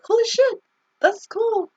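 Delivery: high-pitched amazed exclamation; amazed reaction. Retold or imitated. Acts out reaction to video.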